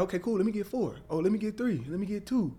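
an excited buyer upping the order; eager customer. Retold or imitated. A fan ordering shirts from him, excited and rushing.

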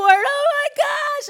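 loud, high, drawn-out wail; mock crying. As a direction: bawl it out, hammed up, to mom. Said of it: "And I'm, like, crying".

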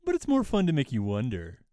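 acted teasing quote, starting high; game character's reply. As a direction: play a cagey video game hero. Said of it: "And he's like".

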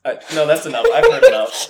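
loud, laughing refusal; laughing loud protest. Real, not acted. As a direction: cut it off, cracking up. Reacting to whisper, laughing.

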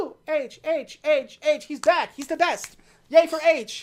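high, loud chanting cheer; mock crowd cheer. The agents cheer H's return, chanting high and loud. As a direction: chant his name like fans. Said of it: "they were just like".

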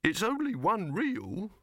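high, slow, peevish objection; testy grumble. A narrator's reading. Silverfish objecting to Dibbler's plans, high and testy. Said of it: "muttered Silverfish testily".

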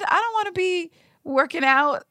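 usual speaking voice

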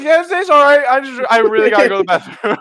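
high, loud, rushed excuse with laughter; flustered brush-off. Retold or imitated. Cutting off small talk to hide his hand, flustered brush-off. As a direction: act a strained cheery escape. Acting out his strained escape.

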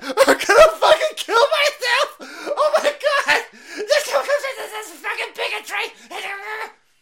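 high, loud laughing hammed-up shriek; mock hysterical shout. Mocking a lefty melting down over Wi-Fi, shrieking. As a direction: scream it like a meltdown.

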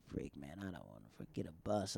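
low, very soft grumble to himself; retold grumble. Stranded in Brooklyn at night, grumbling low.

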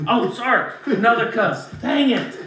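usual speaking voice

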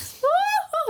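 high, showy cartoon trill; exaggerated high-pitched put-on laugh; villainess laugh. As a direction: laugh like a grand anime lady. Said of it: "kind of girl".

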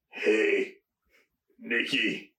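usual speaking voice

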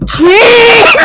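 high, loud, laughing shriek; hammed-up comic yell; mock screaming. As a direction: scream it out for the punchline. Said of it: "you just start screaming".

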